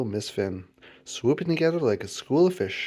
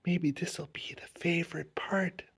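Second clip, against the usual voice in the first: soft, slow, higher whisper; 5 semitones higher, 8 dB quieter than usual. A narrator's reading. Charlie to his hidden pet, whispered. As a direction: whisper it slowly, like a secret. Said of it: "whispered Charlie".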